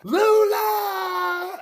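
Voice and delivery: very high, long drawn-out yell; calling out. Acted. Sailor calls to Lula before running to her car, drawn-out acted yell. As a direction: holler the name across a distance, hammed up. Hero calls out for his love.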